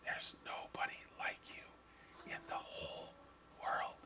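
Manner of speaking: tender unvoiced whisper; hushed loving whisper from Jesus. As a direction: breathe it softly, like a secret told to a child. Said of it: "Jesus whispers to you".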